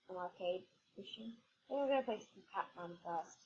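usual speaking voice

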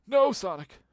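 high, loud "no!" at the game; real alarm as Sonic loses the rings; dismayed cry. Real, not acted. Streamer yelling at Sonic getting hurt, dismayed. Game character takes a hit.

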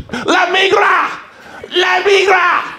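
high, drawn-out shout; acted warning yell, stretched out; mock alarm call. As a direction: holler it slowly at a very high pitch. Comic yells to scare the man.

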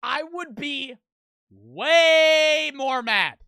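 slow loud demo of real anger; angrier demo. Shown as a demonstration. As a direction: show the actor how mad to get, drawing it out. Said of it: "Maybe act a little more angry".

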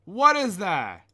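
loud, slow alarmed cry. Real, not acted. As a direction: call out in alarm. Scary sight.

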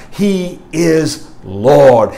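very slow, every word weighted; slow down and land it: He is Lord; solemn proclamation. Acted. Preacher's climax.

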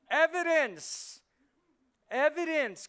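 Evidence. shouted high and slow, stretched out; shouted word. Real, not acted. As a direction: hammer the word Evidence. like a preacher. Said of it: "there should be evidence".

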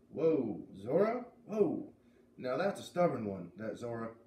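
put-on Maine accent. Zep reacts to Zora.

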